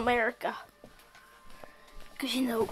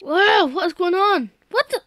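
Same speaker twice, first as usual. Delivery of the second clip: surprised shout; 6 semitones higher, 6 dB louder, slower than usual. Real, not acted. Shocked by game event.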